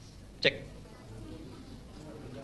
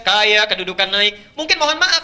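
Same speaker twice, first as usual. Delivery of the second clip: ordinary talk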